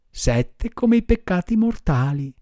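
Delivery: plain reading voice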